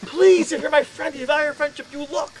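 high, loud begging; desperate plea. Retold or imitated. Begging his roommate Peter again to look, loud desperate plea. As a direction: plead with everything you've got. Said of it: "I'm like".

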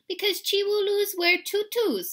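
put-on high, loud little-girl voice; little sister. A narrator's reading. Sister explains tutus to mama, high girlish voice.

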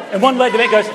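ordinary talk

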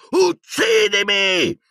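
high, long agonised scream; screamed plea. A narrator's reading. Tormented professor begging to be killed, screamed plea. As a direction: howl it slowly in torment. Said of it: "urlò".

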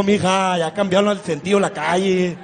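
ordinary talk

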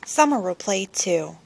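plain reading voice

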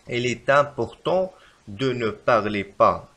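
very slow, stretched dictation; wrong example slowly. Shown as a demonstration. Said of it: "فالخطأ في هذه الجملة".

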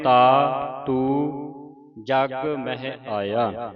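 slow verse, ਤਾ and ਤੂੰ apart with pure vowels; drawn-out recitation, ਤਾ ਤੂੰ as two oral syllables; separate, non-nasal words. Shown as a demonstration. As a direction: hold ਤਾ, then ਤੂੰ, without nasalising. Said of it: "ਬਿੰਦੀ ਤੋਂ ਰਹਿਤ ਪੜ੍ਹਨੇ ਨੇ".

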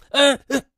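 short high grunts; startled yelps. Acted. Man under attack by the cheated woman, startled yelps. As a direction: yelp as if being throttled. Man being clawed and choked.

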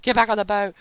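loud imitated panic; mock panic. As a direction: ham up their fear. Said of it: "They're like".

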